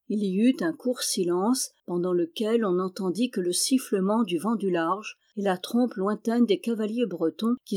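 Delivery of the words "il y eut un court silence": ordinary delivery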